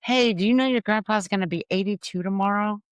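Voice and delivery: high-pitched mock yell of grandma's; retold yell. Grandma yelling from the car window to her grandkid, mock yell. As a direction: call it out high, imitating grandma. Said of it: "and she yells".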